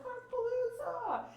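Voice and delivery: put-on high, drawn-out kids' cheer; excited kids cheering. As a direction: shout it as kids bursting out the car. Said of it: "they're like".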